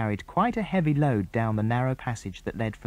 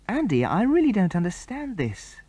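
put-on raised, wondering girl's voice; a puzzled young girl, lifted high; 8 semitones higher than usual. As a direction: sound honestly baffled, pitch up. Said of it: "said Jill in a puzzled voice".

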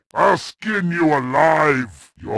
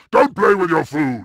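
louder, punching "play" with a falling finish; loud taunt; 4 dB louder, faster than usual. Acted. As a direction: bark it out as a mocking order.

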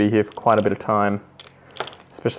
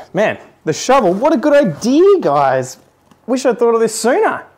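high-pitched mock amazement; hammed-up enthusiasm; sarcastic excitement; 11 semitones higher, 4 dB louder than usual. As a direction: gush over it as if it's a revelation. Note the sarcasm.

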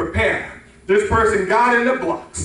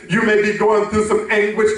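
usual speaking voice

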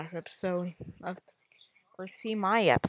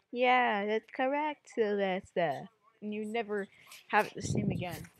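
usual speaking voice

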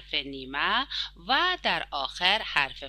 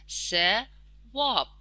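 drawn out very slowly; ثواب said very slowly for young pupils; slow demo word; 3 semitones higher, much slower than usual. Shown as a demonstration. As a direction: stretch ثواب out very slowly, syllable by syllable. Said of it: "اولین کلمه ... ثواب است".

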